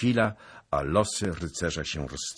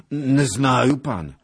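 loud, raised, whining denial; pleading old beggar; 9 semitones higher, 11 dB louder, slower than usual. A narrator's reading. Beggar denies everything to the lord, pleading. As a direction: wail that you know nothing.

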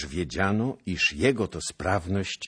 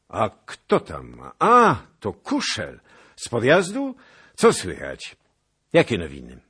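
a hint of the stern prince; 5 semitones higher, 9 dB louder than usual. A narrator's reading. Prince greeting a dusty officer.

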